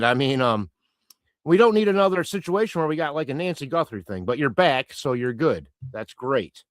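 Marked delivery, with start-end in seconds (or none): none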